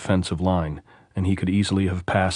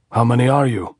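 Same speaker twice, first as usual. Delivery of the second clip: higher, louder sentry challenge; called out; 5 semitones higher, 5 dB louder than usual. A narrator's reading. Sentry challenging Andres from the parapet, calling out. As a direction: call down a wary challenge. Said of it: "someone called from behind the parapet".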